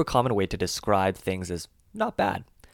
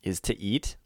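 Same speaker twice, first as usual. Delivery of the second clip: ordinary delivery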